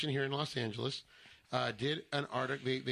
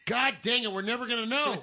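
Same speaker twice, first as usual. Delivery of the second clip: loud, high exasperated outburst; comic yell of giving up; mock frustration; 9 semitones higher, 6 dB louder than usual. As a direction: throw up a playful loud complaint. Mock frustration at unidentified bird sound.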